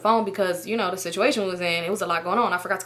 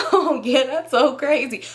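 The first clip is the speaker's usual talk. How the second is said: raised, laughing pretend nonchalance; fake breezy laugh; 5 semitones higher, slower than usual. Covering for being cussed out, fake laughing cheer. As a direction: laugh it off as if all is fine. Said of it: "pretend like I'm not getting cussed out".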